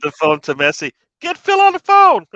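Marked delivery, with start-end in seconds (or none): 1.2-2.2 high, loud mock executive bark; mock boss shouting. Laughing friend voices a club boss ordering staff. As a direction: yell for Phil like a panicked club owner. Miami owners calling for Phil.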